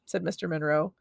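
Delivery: plain reading voice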